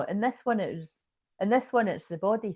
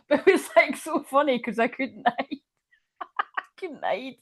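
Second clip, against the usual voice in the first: laughing; 7 semitones higher than usual. Real, not acted. Laughing at own story.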